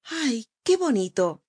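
high, slow, delighted exclamation; cartoon princess. A narrator's reading. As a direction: gasp it out like an enchanted princess. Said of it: "exclamó la princesa".

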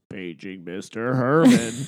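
slow PA announcer voice; Pee-wee movie page over the intercom. Retold or imitated. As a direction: draw out the page like a loudspeaker. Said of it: "all I can think in my head is".

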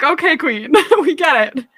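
sassy line breaking into laughter; laughing mock reply. Mocking the coarse-hair heroine, laughing. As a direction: tease the heroine through a laugh. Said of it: "We're like".

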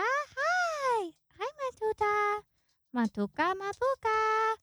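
high, loud cooing pet voice. Real, not acted. Calling her kookaburra to the mic, baby talk. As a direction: coo to a beloved bird.

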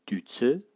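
du tse with du low and tse jumping high and loud; low then high tone. Shown as a demonstration. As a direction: drop on du, leap up on tse. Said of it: "ton bas suivi d'un ton haut".

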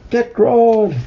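high, drawn-out mock battle cry; storybook yell. A narrator's reading. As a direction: yell it like a mob of apes. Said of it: "the apes yelled".

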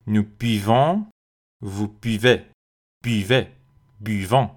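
buvons said with a clear bi-like y, never bu; correct pronunciation. Shown as a demonstration. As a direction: buvez modeled carefully with y.

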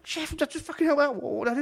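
high, loud begging the chef; frantic cry for help; desperate plea. Retold or imitated. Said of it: "I was like".

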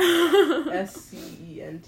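laughing. Real, not acted. Spelling the word, laughing.

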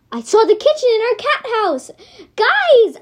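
high, loud holler. Acted. Cat calling friends to the hole, hollering loud and high. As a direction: shout across the room to friends. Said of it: "I hollered".

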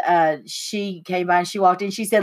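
ordinary talk

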